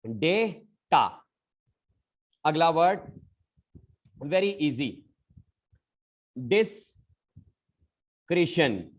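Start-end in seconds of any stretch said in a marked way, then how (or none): 0.1-1.2 "डेटा" very slowly as DAY... taa; syllable-by-syllable demo. Shown as a demonstration. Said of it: "इसको हम लोग बोलेंगे". 6.4-8.8 "डिस्क्रिशन" slowly, dis... kri-shun; syllable-by-syllable demo. Shown as a demonstration. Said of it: "काफी लोग सही बोलेंगे".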